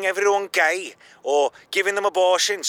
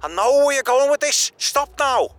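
pleading; 8 semitones higher, 4 dB louder than usual. Acted. John pleads, raised voice.

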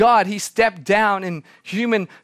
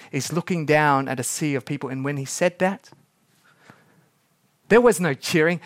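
normal talking voice

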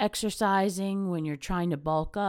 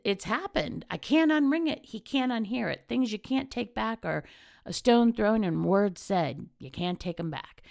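usual speaking voice

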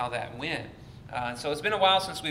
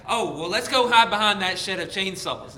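loud, raised mock-enthusiastic decision; kids' silly eagerness; 5 semitones higher, 8 dB louder than usual. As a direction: jump at a dumb idea like a hapless kid. Acted kids agree on silly plan.

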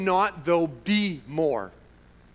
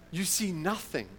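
normal talking voice

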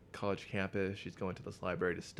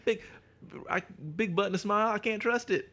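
much higher, louder jeering voice; high mock catcaller; 12 semitones higher, 5 dB louder than usual. Mocking the campus guys, high and loud. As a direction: jeer like a dumb frat guy.

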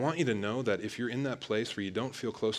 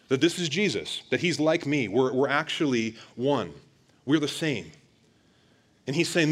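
louder preaching, driving the point home; full-force preaching; 3 semitones higher, 7 dB louder than usual. Acted. Preacher telling his church God's word, at full force. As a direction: proclaim it to the congregation.

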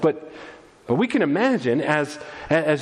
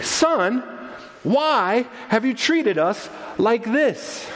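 slow, higher-pitched reproach; mild rebuke; 6 semitones higher, much slower than usual. Acted. Mother to her missing son, mildly rebuking. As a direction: scold gently, drawing out each phrase. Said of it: "gives him a mild rebuke".

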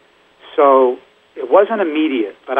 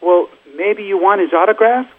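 higher, tentative question, imitating nerves; nervous offer; 9 semitones higher, 4 dB louder than usual. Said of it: "she was so nervous".

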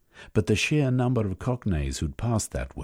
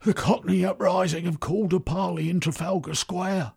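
a breathless messenger's higher voice; urgent young runner; 12 semitones higher than usual. Acted. Runner reports the uprising to the Prime Minister, urgent and high. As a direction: deliver the news in a rush, pitched high.

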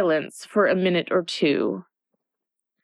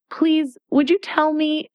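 a touch of timidity, pitched up; 10 semitones higher than usual. A narrator's reading. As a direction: ask shyly, a little higher. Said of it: "looking timidly".

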